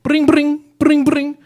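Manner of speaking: high, loud trilled brring; playful trilled sound effect, high and loud; imitated flicking noise. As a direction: mimic a ringing noise in a piercing pitch. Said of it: "trying to make me laugh by flicking at me. He went".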